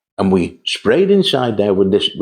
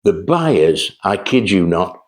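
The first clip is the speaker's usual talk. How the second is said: ordinary talk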